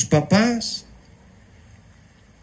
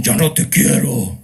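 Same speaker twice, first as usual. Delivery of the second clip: a hint of a possessed, hostile teen voice, louder; mock the kid turned demon; 4 semitones lower, 5 dB louder than usual. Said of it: "así como que se endemonian". (imitación de adolescente endemoniado)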